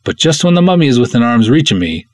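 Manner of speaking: ordinary delivery, nothing marked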